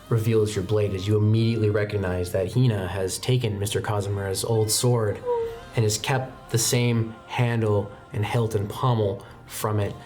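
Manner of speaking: ordinary talk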